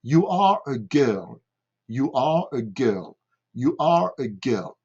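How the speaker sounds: put-on "You are a girl" evenly spaced, untrilled R in "are" and "girl"; pronunciation drill. Shown as a demonstration. Teacher leading a three-time repeat, careful English "You are a girl". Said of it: "nous allons répéter trois fois".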